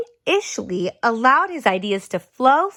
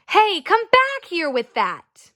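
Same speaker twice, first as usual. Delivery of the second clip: higher, calling her back; calling after her; 7 semitones higher than usual. Acted. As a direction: brother chasing his sister, calling out. Brother chases sister.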